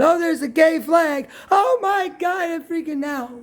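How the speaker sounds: high, hammed-up panic about the flag; self-mocking high panic, pitched well up; mock freak-out. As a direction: squeal it like you're freaking out over nothing. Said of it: "And here I'm saying".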